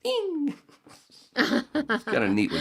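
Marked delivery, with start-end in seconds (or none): 0.0-0.5 high sung ding; a drummer's cymbal ding; imitated ding. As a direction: ring it like a little bell. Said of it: "he went".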